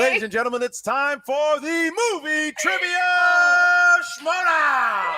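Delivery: slow, stretched hype announcement; ring announcer. Acted. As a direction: draw out the showdown call. Host announces the game segment.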